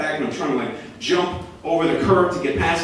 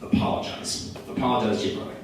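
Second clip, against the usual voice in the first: quiet stern order; 4 semitones lower, 6 dB quieter, faster than usual. Retold or imitated. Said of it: "And he says".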